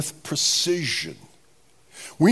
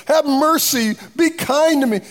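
high, loud acted plea; imitated begging; 11 semitones higher, 5 dB louder than usual. Preacher as people coming to Jesus, acted begging. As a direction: call out for pity in a raised voice.

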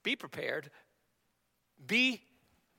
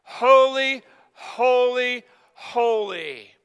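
loud, high, drawn-out proclamation; all-caps exclamation; 5 semitones higher, 5 dB louder, much slower than usual. Shown as a demonstration. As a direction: call it out to lead the congregation, settling on the last. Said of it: "saying in all caps with exclamation points".

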